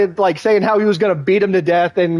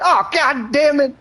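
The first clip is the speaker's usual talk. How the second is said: slow, much higher resigned curse; high drawn-out groan of defeat; 11 semitones higher, slower than usual. Retold or imitated. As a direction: let it sag out high and slow, caught. Craig caught with crack.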